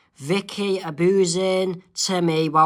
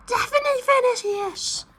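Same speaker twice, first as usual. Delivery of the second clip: squeaky high Isabella. Acted. Isabella answering Phineas on the moon trip, squeaky high.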